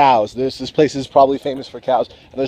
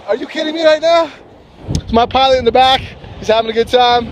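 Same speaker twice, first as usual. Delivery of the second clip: higher and louder, breathless delight; real thrill; 11 semitones higher, 3 dB louder than usual. Real, not acted. Paraglider showing viewers the mountains, giddy and loud. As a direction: gush like you can't believe the view.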